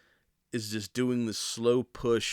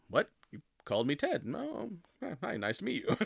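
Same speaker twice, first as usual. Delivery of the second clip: jumps much higher and softer, mock sweet and startled; sudden polite switch; 7 semitones higher, 3 dB quieter than usual. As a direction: flip from beast to timid politeness, lightly played. Werewolf suddenly turns sweet and polite.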